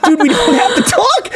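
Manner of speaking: very high, slow pleading shout; laughing retelling of shouting at a sick man; retold high shout. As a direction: beg him to stop straining his voice. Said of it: "I was like".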